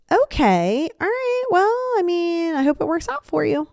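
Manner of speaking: high, drawn-out fake-polite disapproval; passive aggressive. Shown as a demonstration. Friend giving up on criticizing an outfit, passive aggressive. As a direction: go high and stretch it, barely hiding the disapproval. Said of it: "Passive aggressive would be like".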